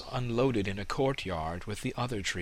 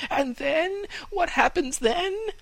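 high, breathless pleading fir tree voice; trembling eager young tree; 4 dB louder than usual. Acted. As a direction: beg to hear more, trembling. Fir trembling asks more.

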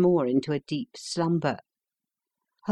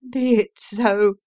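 resigned prisoner; 4 semitones higher, 4 dB louder than usual. A narrator's reading. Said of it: "said he".

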